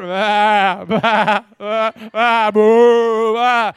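imitated farm animal cries, very loud; loud sheep bleating. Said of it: "all the bleating of the sheep".

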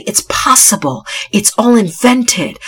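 ordinary delivery

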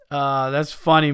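ordinary delivery

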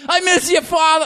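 high-pitched Boston melodrama; mock anguish. Consoling a bereaved Bostonian, overwrought high voice. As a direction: cry out in a hammed-up Boston voice.

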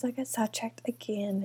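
fast whisper to herself; soft self-conscious whisper; whispered aside. Real, not acted.

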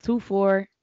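ordinary talk, nothing marked

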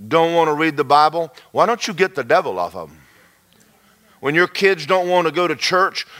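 ordinary talk, nothing marked

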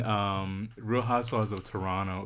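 usual speaking voice

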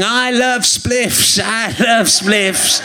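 slow, drawn-out drunken bellow, imitated; drunk man from Barrow. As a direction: draw out each word like a merry drunk. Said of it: "two guys from Barrow who were very drunk".

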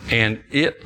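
normal talking voice

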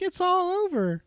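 high, small reindeer voice; Rudolph voice. Retold or imitated. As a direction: squeak it out like a tiny reindeer. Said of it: "Rudolph comes trotting in like".

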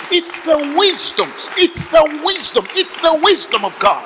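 loud, high, building preaching cry; preaching shout. Acted. Preacher building to a shout to the congregation.

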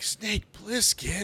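high, drawn-out name in awe; hammed-up starstruck reaction; mock amazed recognition. Acting out everyone's awed recognition.

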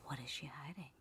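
hammed-up conspiratorial whisper; mysterious whisper. Host wondering what Mommy hides, mock-mysterious whisper.

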